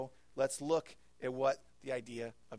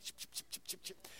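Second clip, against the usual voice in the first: whispered chip chip of corduroys rubbing; corduroy swish noise; 10 dB quieter than usual. Retold or imitated. Pastor mimicking his corduroys swishing as he ran, breathy and soft. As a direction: imitate the swish of corduroy pants running. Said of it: "corduroys that made noise when he ran".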